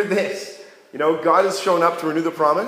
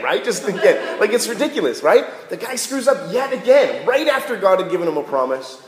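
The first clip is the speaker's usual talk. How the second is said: usual speaking voice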